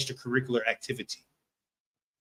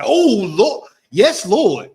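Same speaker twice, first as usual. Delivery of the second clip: higher and louder, overjoyed "Oh, Lord"; excited praise; 5 semitones higher, 10 dB louder, much slower than usual. Retold or imitated. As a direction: cry out praising the Lord over the chicken. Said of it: "You be like".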